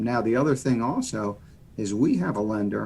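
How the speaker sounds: normal talking voice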